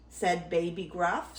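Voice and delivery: ordinary delivery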